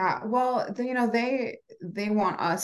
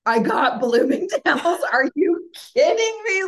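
much higher and louder, slow, laughing through tears; ecstatic disbelief; 11 semitones higher, 7 dB louder than usual. Retold or imitated. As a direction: squeal it out, laughing and half crying with joy. Reads contract, gets Bloomingdale's.

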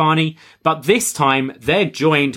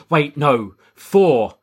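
ordinary delivery

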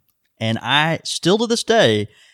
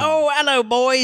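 put-on much higher hammy British "hello, boys"; falsetto-ish mock British "Oh, hello, boys"; mock British greeting; 12 semitones higher than usual. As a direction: cohost teasingly echoing a listener's greeting in mock British. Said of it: "Joel from foggy London town".

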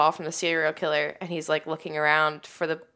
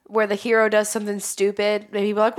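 normal talking voice

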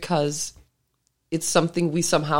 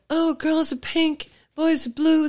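mocking voice; 10 semitones higher than usual. Mocking gender stereotype.

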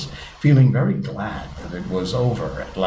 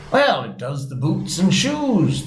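slow, higher-pitched solemn reply; 8 semitones higher, slower than usual. A narrator's reading. Griffin answers Alice's question about whiting, solemnly. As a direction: draw it out with mild gravity. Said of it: "the Griffin replied very solemnly".

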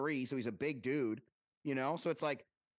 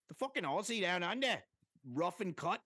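bad Australian accent; 3 semitones higher than usual. Describing Cam Smith's attitude, a clumsy put-on Australian accent. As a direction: fake an Australian accent badly, speeding up at the end. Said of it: "That was the worst Australian accent ever".

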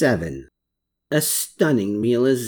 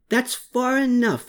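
breathless call; 10 semitones higher than usual. Acted. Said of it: "he called out while both gasping for breath".

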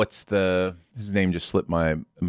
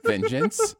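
"vengeance" very high, normally pronounced; high questioning repeat. Shown as a demonstration. Co-host defending their pronunciation, high-pitched "vengeance". Answers the mimicry with own take.

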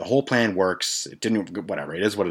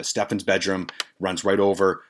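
ordinary talk

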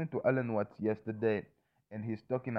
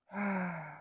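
long audible out-breath, higher and airy; demonstrated sigh; 9 semitones higher than usual. Shown as a demonstration. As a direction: show a slow releasing breath. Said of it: "And then you just breathe".

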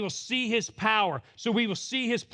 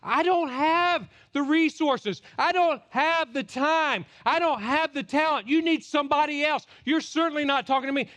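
exasperated protest; 8 semitones higher, 3 dB louder than usual. Acted. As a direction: plead that it is beyond you. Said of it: "what you're gonna say".

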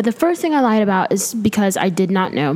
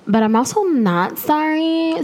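sassy not sorry; 9 semitones higher, slower than usual. Pretend. Playful defiance about tweets.

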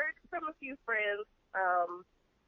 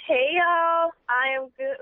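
southern drawl greeting, louder and higher; 4 semitones higher, 6 dB louder, slower than usual. Real, not acted. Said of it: "I sounded so southern just then".

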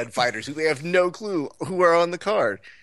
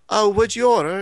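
high, louder mock Australian-British accent; 9 semitones higher, 6 dB louder than usual. Lady at the bar asking about food, mock Australian-British accent. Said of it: "like, Australian, British, whatever the fuck her accent was".

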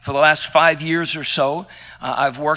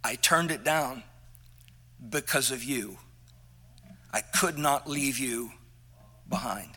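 much softer and lower, holding back tears; tender, near tears; 6 semitones lower, 11 dB quieter than usual. Retold or imitated. Quoting his trainer's sacrifice, hushed and near tears. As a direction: let it fall away, moved almost to tears. Trainer's touching confession, retold.

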